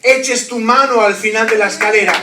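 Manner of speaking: loud, driving preacher's call; rally the congregation with full force; predicación a pleno pulmón. Acted. Predica con fuerza. (exhortación de predicador en voz alta)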